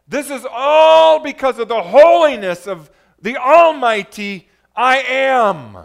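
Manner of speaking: high, very loud proclamation; preaching at full force. Real, not acted. Preacher tells congregation why the temple shakes, impassioned loud preaching. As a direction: thunder it out to the congregation.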